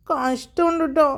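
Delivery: put-on slow, sulky mutter of a pouting girl; sulky complaint. A narrator's reading. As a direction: drag it out, pouting at mom. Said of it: "എന്ന് പറഞ്ഞ് സാക്ഷി".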